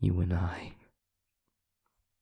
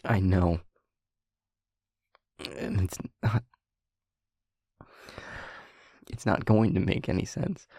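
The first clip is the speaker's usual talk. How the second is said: rising urgency. Acted. Voice rising as she pleads.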